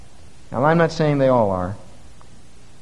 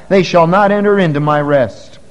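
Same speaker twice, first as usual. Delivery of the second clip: they shall not enter into my rest, louder with a falling end; preacher booming God's oath; loud pulpit declaration; 3 semitones higher, 6 dB louder than usual. Acted. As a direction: proclaim it loudly. Quote then quiet repeat.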